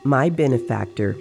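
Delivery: usual speaking voice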